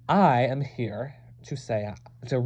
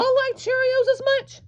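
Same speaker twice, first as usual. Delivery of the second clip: much higher, altered voice; incredulous squeak. Acted. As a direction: squeak it in disbelief. Incredulous high question.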